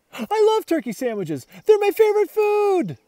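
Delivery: delighted high squeal; excited dog. Acted. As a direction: gush over the food like a greedy dog. Dog raving about food.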